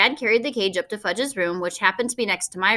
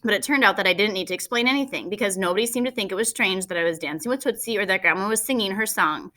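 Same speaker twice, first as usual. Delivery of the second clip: usual speaking voice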